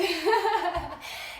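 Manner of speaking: short laugh through panting; breathless laugh. Real, not acted.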